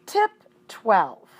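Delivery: announcer voice for the tip title; announcing tip. A narrator's reading. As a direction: read it like a heading. Title heading.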